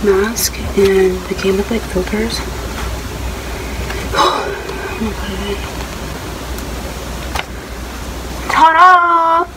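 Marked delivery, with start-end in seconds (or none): 2.4-8.4 long breathy gasp of delight; excited gasp. Real, not acted. Reveals aunt's new masks. 8.5-9.4 high, loud ta-da; excited reveal. Real, not acted. Showing off the masks.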